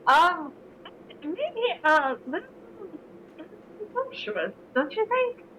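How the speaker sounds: high, coy and doubtful; mock doubtful. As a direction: play it coyly presumptuous. Character replies with raised pitch.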